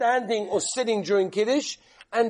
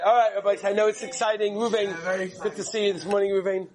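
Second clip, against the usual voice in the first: ordinary delivery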